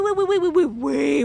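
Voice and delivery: normal talking voice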